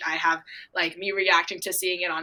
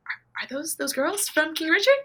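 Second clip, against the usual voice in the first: high-pitched stammer of recognition; starstruck disbelief; 11 semitones higher, 3 dB louder than usual. Retold or imitated.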